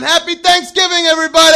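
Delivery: high, loud holiday holler; calling to crowd. Retold or imitated. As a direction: greet a whole parade crowd at the top of your voice. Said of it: "I'm going up to the sidelines going".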